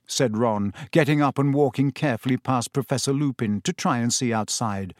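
usual speaking voice

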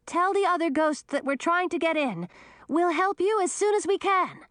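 high yell at a door. A narrator's reading. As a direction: shout through the door. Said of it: "yelled at the door".

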